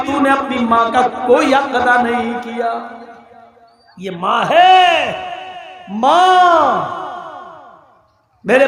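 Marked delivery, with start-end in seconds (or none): 4.0-7.0 slow, drawn-out passionate cry of "ماں"; impassioned cry. Acted. The preacher urges his brothers to honour mothers, a slow impassioned cry.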